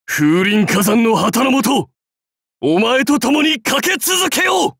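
high ringing war cry; impassioned battle pledge. Acted. Warlord pledging to ride on with master, impassioned shout. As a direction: shout it like a charge under the banner.

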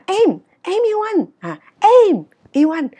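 "em" high, loud and stretched; stress on EM. Shown as a demonstration. Teacher insisting it is me and nobody else, stress on EM. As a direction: hit "em" hard up top, slowly. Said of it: "Tức là tiếng Việt mình muốn nói là em".

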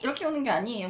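normal talking voice

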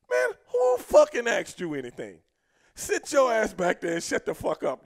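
slow, loud retold scolding; scolding putdown. Veterans shutting up the rookie in the back seat, loud slow scolding. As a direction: put him in his place, loud and drawn out.

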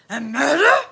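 loud gruff question, rising; growly officer, rising in doubt; incredulous policeman. Acted. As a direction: repeat it in loud disbelief. Growly policeman repeats in disbelief.

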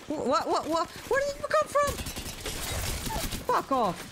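high-pitched startled stammer rising to a squeal; real shock at being ambushed. Real, not acted. Player ambushed by an unseen enemy, startled.

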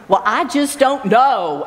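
"I" and "know" leaned on, slowed down; shifted emphasis. Retold or imitated. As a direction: stretch it out, stressing "I" and "know". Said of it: "just emphasize different words".

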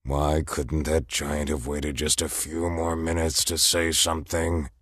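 low, grumbling private lament; inner thought. Acted. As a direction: mutter the complaint to yourself. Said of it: "Volstagg thought".

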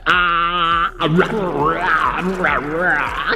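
drawn-out low mock baby wail; mimicking a toddler's roaring babble; imitated baby babble. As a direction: stretch out a slow, low imitation of baby noises. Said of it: "She said".

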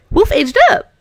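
high, loud cheer; excited cheer. Real, not acted. Player celebrates the dog growing up, high cheer. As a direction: announce it with delight. Pet milestone.

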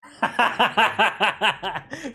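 loud, rising laugh. Real, not acted. Laughs at teammate's comment.

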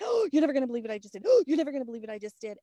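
fast, loud giddy babble; breathless excitement. Retold or imitated. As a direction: rattle it off, bursting with news. Can't stop talking, excited sharing.

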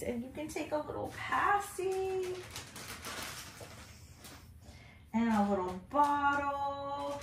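high, soft cooing. Real, not acted. Baby talk to doll.

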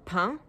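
pain said the standard French way, nasal vowel. Shown as a demonstration. Vlogger showing standard French pain. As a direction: say pain like in Paris. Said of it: "for example".

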